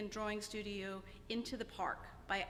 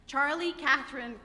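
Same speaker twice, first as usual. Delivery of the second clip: normal talking voice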